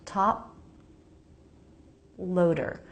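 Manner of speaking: "top loader" with the sound cut at the P and a long gap; stopped P. Shown as a demonstration. Teacher showing how P stops sound, "top loader" split by a pause. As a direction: hold the lips shut after "top", then release straight into "loader". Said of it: "that stops the sound".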